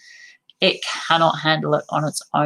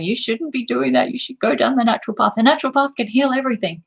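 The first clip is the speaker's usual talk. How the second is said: preachy lecturing; 7 semitones higher, faster than usual. Retold or imitated. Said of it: "And then me going".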